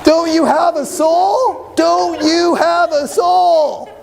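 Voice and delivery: slow, high appalled cry of disbelief; shocked outcry. Retold or imitated. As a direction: demand it in mock disbelief. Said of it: "That's what you wanna ask".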